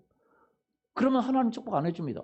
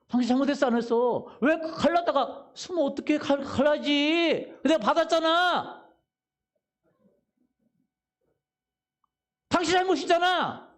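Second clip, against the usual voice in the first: acted angry yell; 6 semitones higher, 3 dB louder than usual. Rear driver yelling blame.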